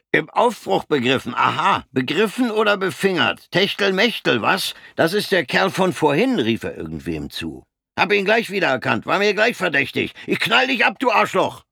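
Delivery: höher und lauter, zunehmend außer sich; betrunkener Soldat brüllt. Acted. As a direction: steigere dich vom Spott zur Morddrohung. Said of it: "brüllte der Soldat". (der betrunkene Soldat droht Fritz, höher und lauter brüllend)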